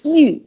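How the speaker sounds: YU held level, without changing tone; first tone. Shown as a demonstration. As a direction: keep YU high and steady. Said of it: "you don't change your tone at all".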